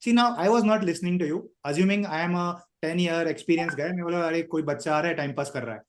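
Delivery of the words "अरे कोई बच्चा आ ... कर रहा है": imitating a bored senior interviewer, low and quick; dismissive attitude. Mentor plays experienced interviewer writing off a kid, dismissive. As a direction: brush the candidate off under your breath. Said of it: "This is our attitude".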